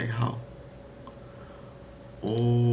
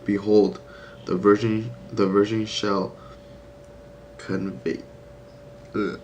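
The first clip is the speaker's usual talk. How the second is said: reading scripture; slower than usual. Acted. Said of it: "The Bible says".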